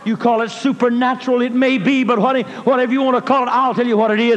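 high, loud, rhythmic preaching with uh-fillers. Real, not acted. A preacher telling the congregation of God's power, full-force preaching. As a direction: preach it over the music.

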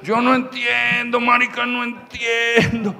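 slow, drawn-out complaint; hammed-up incomprehension; exasperated bewilderment. Retold bewildered complaint about coal.

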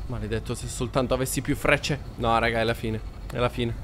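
ordinary talk

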